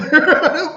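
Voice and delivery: high loud laugh bursting out; loud burst of laughter. Real, not acted. Laughing hard while telling the chaplain story. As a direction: let the laugh explode.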